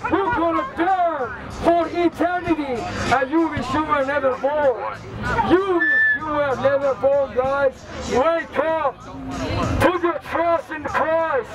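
normal talking voice

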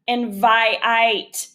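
"vi-ite" with the i drawn out long; stretched long vowel. Shown as a demonstration. Teacher to students, showing the long vowel by stretching it. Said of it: "I is our long vowel".

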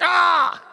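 sharp high yelp of pain; mock pain shout. Imitating Jared yelling as his shoulder breaks. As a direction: cry out like a shoulder just popped. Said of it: "He was like".

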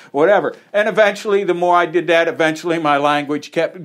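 ordinary talk, nothing marked

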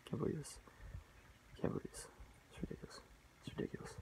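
hushed, breathless disbelief; stunned muttering. Retold or imitated. Recalling the upsetting death, hushed disbelief.

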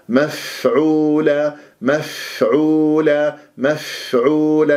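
"مفعولة" slowly, with breath flowing on the fa; slow recited "مفعولة" with a clear whispered fa; audible airy fa. Shown as a demonstration. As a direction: let the fa of "مفعولة" hiss out before the long vowel. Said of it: "يعني الهمس فيها حتى نسمع الفاء".

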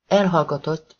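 ordinary delivery

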